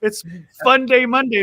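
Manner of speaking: loud, punchy host hype; hyped show intro. Real, not acted. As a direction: kick off the show with a loud burst. Hyping up the show's Monday opening.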